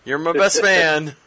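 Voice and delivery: slow, loud insistence. Acted. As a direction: insist loudly and slowly. Repeated louder, slower.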